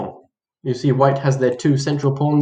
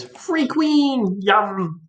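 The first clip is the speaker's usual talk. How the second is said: high-pitched mock delight; gleeful greed; 7 semitones higher, much slower than usual.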